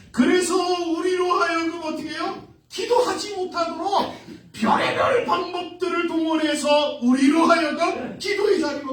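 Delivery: high, pressing sermon voice; forceful preaching. Acted. Preacher warning of Satan's hindrance, high forceful delivery. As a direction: hammer out the warning to the flock.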